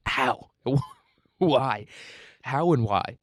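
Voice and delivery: incredulous, pleading how and why; baffled questioning. Retold or imitated. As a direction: ask it as if utterly stumped. Acts out own bafflement at concept.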